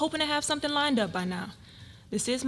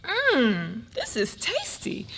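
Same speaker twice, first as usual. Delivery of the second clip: savoring; much slower than usual. Acted. First sip of tea.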